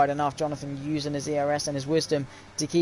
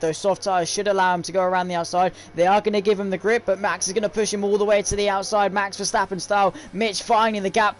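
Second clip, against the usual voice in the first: rising, louder race call as the overtake unfolds; excited commentary; 3 semitones higher, 4 dB louder than usual. Real, not acted. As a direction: build the excitement through the battle. Battle for the race lead.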